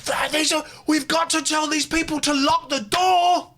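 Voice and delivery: high-pitched comic shouting; hammed-up high yell of alarm; mock outraged yell. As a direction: yell it in exaggerated exasperation. Mock outburst about unlocked doors.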